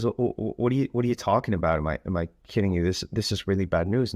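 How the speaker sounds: fast, stuttering mock confusion; flustered stammering husband. Husband answers his wife's strange reaction, flustered. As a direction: imitate a baffled husband, rushed and tripping over words.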